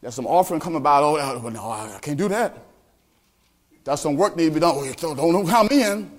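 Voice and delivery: low, lazy excuses, voice dropped; mock the member dodging work; reluctant churchgoer. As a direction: churchgoer backing out of service. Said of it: "But as a sign".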